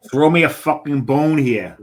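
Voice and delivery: put-on a half-hearted British accent that drifts off course; wrong accent. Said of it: "I used the wrong accent there".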